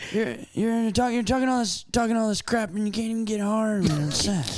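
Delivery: high, halting old politician impression; mock presidential debate voice; Joe Biden. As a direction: stumble and restart like a rambling politician. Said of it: "It's pretty good Biden".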